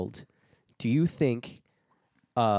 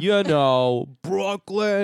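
mock Brooklyn accent; 7 semitones higher, 5 dB louder, much slower than usual. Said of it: "That's in Brooklyn".

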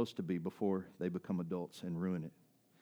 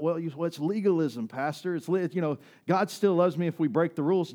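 loud, raised-pitch mock protest; higher, louder imitation of an objection; protesting objector; 6 semitones higher, 7 dB louder than usual. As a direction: argue back like an indignant churchgoer. Voices an objector arguing with pastor.

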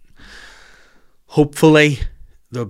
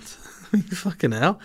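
laughing disgust; 7 semitones higher, much slower than usual. Real, not acted. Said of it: "oh no, this is gross".

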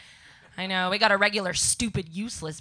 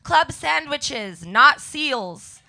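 slow, punched chalkboard words; slow read-out; 4 semitones higher, 7 dB louder, much slower than usual. Retold or imitated. Comic reading her chalkboard slogan slowly. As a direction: spell out each word deliberately. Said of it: "so I wrote".

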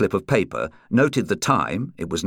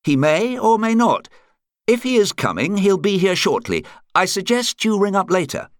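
higher-pitched captain taking a call; club captain on phone; 12 semitones higher than usual. A narrator's reading. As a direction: advise the caller, voice pitched up.

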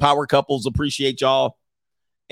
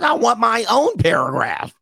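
mock whiny wife; 9 semitones higher than usual. Melinda voiced whiny.

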